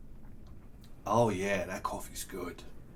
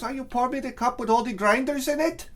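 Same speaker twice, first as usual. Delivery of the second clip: mock wife voice; 6 semitones higher than usual. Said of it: "She doesn't really talk like that".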